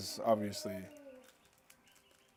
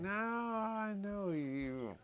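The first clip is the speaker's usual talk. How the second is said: higher and slow, savoring it; slow knowing stranger; 9 semitones higher, 6 dB quieter, much slower than usual. Retold or imitated. The stranger finally placing him, slow and pleased. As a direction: draw it out with satisfaction.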